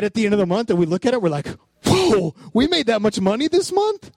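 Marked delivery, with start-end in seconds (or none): 1.9-4.1 high, drawn-out amazed whoa; slow, stunned disbelief pitched way up; freaking out. Retold or imitated. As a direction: gape at an unexpected windfall. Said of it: "And we're freaking out".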